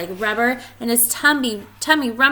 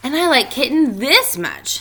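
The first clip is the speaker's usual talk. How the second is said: boastful; 5 semitones higher, 6 dB louder than usual. Acted. Spike outdoing Splat.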